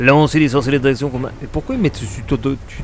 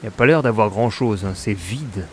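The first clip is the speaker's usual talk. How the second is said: ordinary talk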